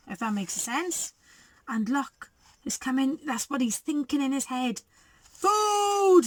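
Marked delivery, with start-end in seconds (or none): 5.3-6.2 a high booming growl of a word; thundering roar. A narrator's reading. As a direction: roar it like a hungry dinosaur. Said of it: "thundered Tiny".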